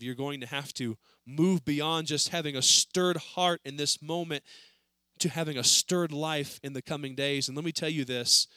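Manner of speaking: ordinary talk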